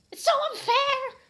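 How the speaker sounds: high, loud wail of unfairness; shrieking tantrum. Acted. Reader voices Ebony wailing at Draco, high shrieking tantrum. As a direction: screech it like a sulking teen.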